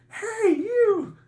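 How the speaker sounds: very high, loud, drawn-out holler; mock threatening yell. Frat brother yells after fleeing Dickie, mock threat. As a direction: act out yelling after someone running away.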